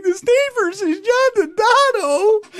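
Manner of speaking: high, slow mock-weepy mom voice; mother crying. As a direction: wail it like a tearful mother, pitched high. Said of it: "cry because she's like".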